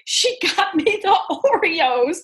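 high, crying out the name with a break of joy; delighted surprise. Retold or imitated. Mom discovering the Oreos, overjoyed high shout. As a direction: shout it like a kid who just found the prize. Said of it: "I'm like".

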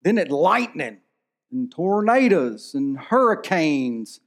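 ordinary talk

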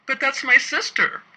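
high, loud blurt; blurted defense. A narrator's reading. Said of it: "I blurt out in defense".

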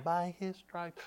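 barely audible murmur; a tiny, very soft voice within; low inner voice. Retold or imitated. Inner voice answering the devil, very quiet. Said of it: "like, low on the inside".

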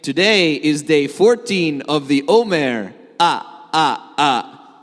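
loud accented cartoon counting voice with staccato laugh; Count Von Count. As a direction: announce it like a counting-obsessed puppet count. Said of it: "he would probably say".

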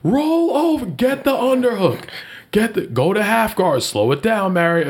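imitating a coach yelling from the sidelines; coach calling out. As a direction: call out moves like a cageside coach mom. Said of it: "coaching with the baby on".

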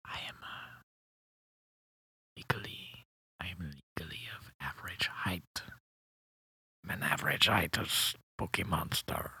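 halting, very hushed mock-French whisper; whispered secret. Little man confesses his height to the host, whispered.

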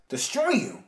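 put-on eager young hero asking; Spider-Man voice. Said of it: "Spidey replies".

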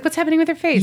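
high puzzled inner voice. Retold or imitated. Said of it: "I was like".

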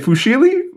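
"Fusilli" as a high foo-SHEE-lee, rising; questioning mispronunciation. Real, not acted. Asking co-host how to say it, high rising foo-SHEE-lee for "Fusilli". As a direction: ask "Fusilli" with a sh in the middle. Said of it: "how do you say it".

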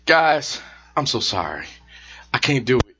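a hint of a sad singer, low and soft. Host imitates [name] apologizing to fans, soft and low. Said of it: "she woke up this morning".

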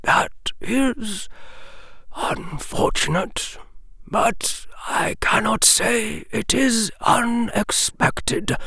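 put-on measured, grave old wizard. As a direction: speak as a wise old headmaster. Dumbledore speaks to Hermione.

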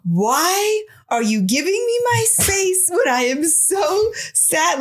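high, drawn-out mock wail; hammed-up angry whine. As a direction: complain with exaggerated hurt and anger. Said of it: "And then I get very angry".

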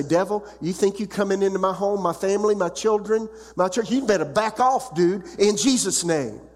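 commanding the devil. Acted. Preacher ordering the devil away from home and church, defiant command. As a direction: order the intruder off your family with authority. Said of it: "understanding how to say".

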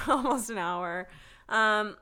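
normal talking voice

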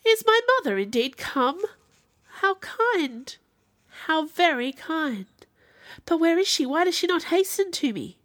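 high, eager, delighted questions; acted rush of joyful anticipation; overjoyed. A narrator's reading. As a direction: pour out relief and pleasure, pitched well up. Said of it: "anguish to pleasure".

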